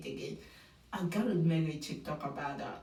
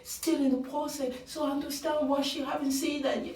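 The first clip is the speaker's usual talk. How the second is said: much higher understanding tone; imitating Grant; 7 semitones higher than usual. Host voicing Grant excusing Juliana, high mock sympathy. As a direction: play him as overly understanding. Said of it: "He said".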